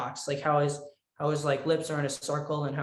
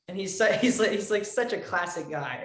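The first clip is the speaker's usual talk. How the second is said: usual speaking voice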